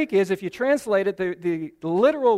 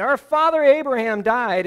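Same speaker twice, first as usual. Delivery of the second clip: indignant protest; 5 semitones higher, 6 dB louder than usual. Retold or imitated. Pharisees protest to Jesus.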